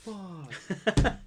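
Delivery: high-pitched, drawn-out mock dismay; exaggerated high groan of shock; retold cliffhanger reaction. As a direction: imitate a crowd left hanging. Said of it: "left on that cliffhanger, they're like".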